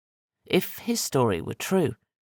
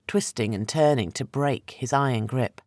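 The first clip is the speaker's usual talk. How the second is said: plain reading voice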